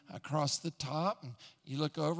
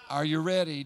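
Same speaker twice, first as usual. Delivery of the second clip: louder, pressing the question home; louder repeat; 7 dB louder than usual. Shown as a demonstration. Preacher voicing the Lord asking the flock again, louder. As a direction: call it out to the room. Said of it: "Are you ready? repeated".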